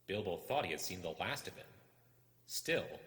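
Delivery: ordinary delivery, nothing marked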